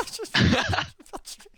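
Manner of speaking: high, loud panicked laugh. Real, not acted. Laughing while about to die.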